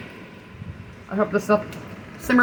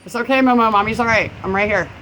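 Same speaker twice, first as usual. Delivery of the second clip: raised-pitch mommy-to-pet reassurance; higher voice, settling as it goes; comforting; 5 semitones higher, 5 dB louder, faster than usual. Real, not acted. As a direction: soothe a scared pet.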